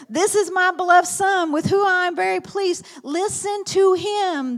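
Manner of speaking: loud, high proclamation; booming divine voice. Acted. God speaking from heaven at the Transfiguration, loud and high. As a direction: boom it out from above.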